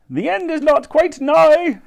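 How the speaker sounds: high, slow revised proclamation; doom-monger's shout. A narrator's reading. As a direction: shout it after them, stretching the last word. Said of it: "he shouted as they passed".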